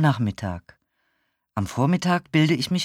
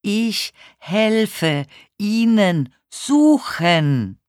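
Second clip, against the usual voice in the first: very slow, high, word by word; over-enunciate for someone hard of hearing; especially loud and clear; 8 semitones higher, 6 dB louder, much slower than usual. A narrator's reading. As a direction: separate each word and project it. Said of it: "besonders laut und besonders deutlich auszusprechen".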